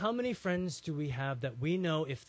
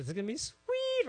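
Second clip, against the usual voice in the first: jumps far higher with relish; gleeful gloat; 3 dB louder than usual. Retold or imitated. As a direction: savour the coming revenge. Hosea gloating about punishing.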